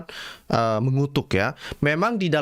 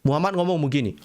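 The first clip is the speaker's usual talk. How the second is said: ordinary delivery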